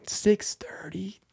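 slow, high whispered disbelief; incredulous whisper. Acted. Comic repeating an absurdly early bedtime, incredulous whisper. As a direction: whisper it slowly, as if scandalized.

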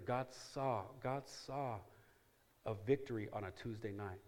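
usual speaking voice

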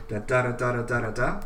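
da da da da in four stressed pairs; tetrameter beats. Shown as a demonstration. Poet scans her tetrameter line, chanting the beats. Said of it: "iambic tetrameter".

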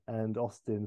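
ordinary talk, nothing marked

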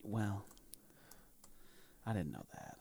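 dry whispered aside. Real, not acted. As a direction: whisper it, deadpan. Quiet aside after candle remark.

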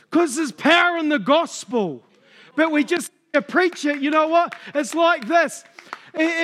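rally the church to go and share the gospel; fervent preaching. Acted. Preacher urging the congregation to evangelise, fervent rising preaching.

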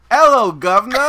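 loud, high mock English greeting; reluctant performer going full cartoon English; Harry Potter accent. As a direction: belt it out in a hammed-up British accent. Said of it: "your best Harry Potter accent".

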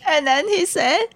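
ordinary talk, nothing marked

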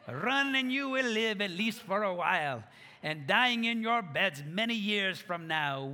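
rousing Scottish battle speech, building to a shout; Scottish accent. Retold or imitated. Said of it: "afraid that was gonna turn into a Jamaican accent".

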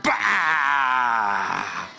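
low shouted blast; shouted bang sound; explosive shout. Shown as a demonstration. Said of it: "I want to explode it".

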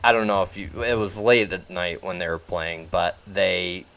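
usual speaking voice

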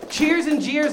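rally the room with each slogan; rallying catchphrases. Acted. Host pumps up the audience with his catchphrases, rallying call.

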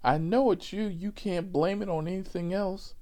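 high, heated back-seat accusation; mock angry accusation. Woman on the right accusing her seatmate of farting, high mock anger. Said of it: "So the woman on the right".

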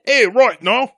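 loud, high British caricature. Acted. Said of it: "Say it again".

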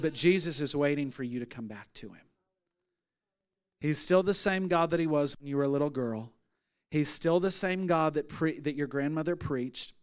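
low, soft pastoral appeal; hushed, unhurried comfort; gentle reassurance. Retold or imitated. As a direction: tenderly coax a hurting person back. Retold gentle ministering to crying woman.

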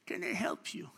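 put-on high, hushed old man's offer; soft offer. Old man to stranded young driver, soft offer.

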